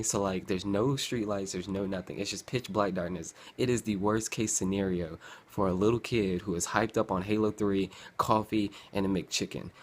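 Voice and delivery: ordinary talk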